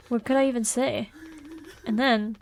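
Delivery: ordinary talk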